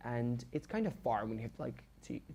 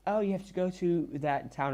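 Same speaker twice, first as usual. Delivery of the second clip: mildly higher, sugary put-on teacher voice; a touch of syrupy false friendliness; fake nice; 6 semitones higher, 3 dB louder than usual. Teacher fake-nicely asks the student about her trip. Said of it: "so fake nice to me".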